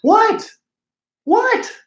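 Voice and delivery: high-pitched incredulous yelp; squeaky, defensive disbelief; mock indignation. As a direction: act affronted, pitch shooting up. Mock indignation at online criticism.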